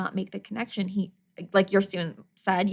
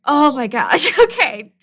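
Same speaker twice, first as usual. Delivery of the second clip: laughing realization; 6 semitones higher, 9 dB louder than usual. Retold or imitated. Teacher realizing problem.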